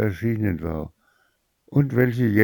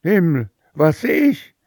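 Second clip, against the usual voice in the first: much higher, louder shocked cry; horrified scream; 10 semitones higher, 4 dB louder than usual. Acted. The mother sees snakes and toads, horrified. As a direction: Himmel shrieked in horror. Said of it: "schrie die Mutter".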